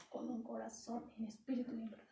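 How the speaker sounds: hushed, intimate plea; whispered prayer. Retold or imitated. Preacher voicing the psalmist's prayer, whispered. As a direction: drop to a breathy whisper of devotion. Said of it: "él decía".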